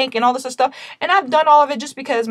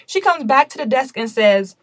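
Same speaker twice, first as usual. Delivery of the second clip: normal talking voice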